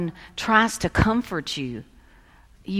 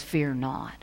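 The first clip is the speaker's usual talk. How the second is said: "Fear not" said softly, dropping low; hushed quote; 5 semitones lower, 7 dB quieter than usual. Retold or imitated. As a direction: let "Fear not" fall quiet like a gentle quote. Quoting angel, then repeating emphatically.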